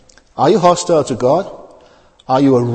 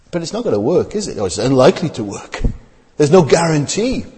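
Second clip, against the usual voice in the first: normal talking voice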